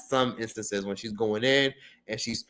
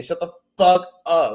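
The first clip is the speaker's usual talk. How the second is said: louder, higher mock telling-off; imitated fed-up viewers; 6 semitones higher, 6 dB louder than usual. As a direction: punch it out like an annoyed audience. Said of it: "I know y'all thinking".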